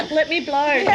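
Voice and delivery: laughing while speaking. Real, not acted. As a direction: giggle through the words. Laughing at award mention.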